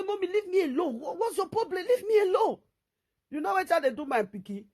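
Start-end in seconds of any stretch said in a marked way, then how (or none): 0.0-2.6 put-on high, annoyed child begging to be left alone; whiny kid. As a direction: whine it like a fed-up little boy. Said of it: "Go say".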